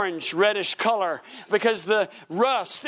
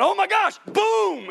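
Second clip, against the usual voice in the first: startled shout; 11 semitones higher, slower than usual. Retold or imitated. Preacher reliving stomping on the spider, startled high shout.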